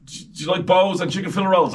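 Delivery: high, loud silly question; mock interviewer question. Interviewer asking superstars a daft question, high and loud. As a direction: put on an over-keen interviewer's pitch.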